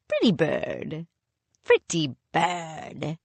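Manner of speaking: soft, slow coo; cooing to a bird. A narrator's reading. Pirate captain coos at the canary, soft and slow. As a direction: coax the bird tenderly.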